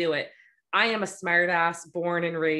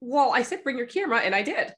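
mock flustered friend; 5 semitones higher than usual. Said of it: "And they're like".

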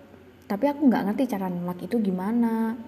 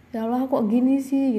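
a touch of despair, slightly louder; despairing sigh to God; 3 semitones higher, 5 dB louder than usual. Retold or imitated. Alone at night, questioning God, mild despair.

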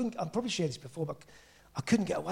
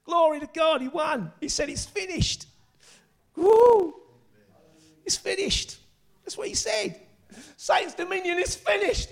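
high-pitched, exultant preacher with bursts of shouting; excited preaching; 10 semitones higher, slower than usual. Real, not acted. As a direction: celebrate a victory from the pulpit.

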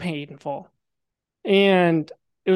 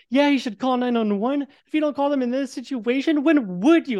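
retold furious yell, pitched up high; bleeding man yelling at bystander, higher; enraged yell; 7 semitones higher than usual. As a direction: snap back at a clueless bystander, higher. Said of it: "I yell this back".

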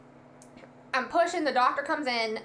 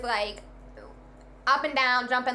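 ordinary talk

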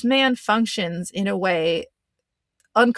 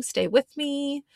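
high pleading; 7 semitones higher, slower than usual. Real, not acted. Playful plea to listeners.

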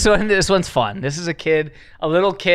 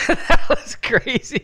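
laughing; 7 semitones higher, much slower than usual. Real, not acted. Echoing with a laugh.

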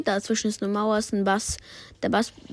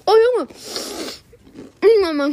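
loud gasps, mouth on fire; reacting to burning hot chips; mouth burning from spicy food; 9 semitones higher, 4 dB louder than usual. Real, not acted. Eating extremely spicy chips. (brennender Mund von scharfen Chips)

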